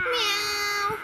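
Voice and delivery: high cutesy meow; cat meow. Shown as a demonstration. Mom echoing her toddler with a high meow. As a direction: play the kitty, high. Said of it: "What did the cat say?".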